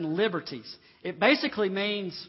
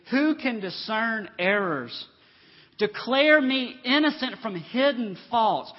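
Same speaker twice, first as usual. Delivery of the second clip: slow, raised pulpit reading; scripture proclamation; 5 semitones higher, 7 dB louder, slower than usual. Acted. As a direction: read the verse out weightily, stretching key words.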